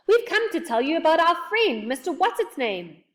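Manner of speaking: loud, high insistence; quite cross. A narrator's reading. Joe explaining the visit to a deaf man, loud and cross. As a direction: spell out your errand loudly, exasperated. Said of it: "feeling quite cross".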